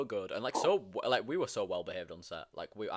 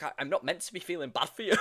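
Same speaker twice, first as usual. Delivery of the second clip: imitated upset protest; 7 semitones higher, 5 dB louder than usual. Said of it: "he kept tearing up".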